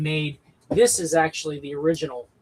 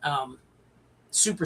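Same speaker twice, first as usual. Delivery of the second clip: normal talking voice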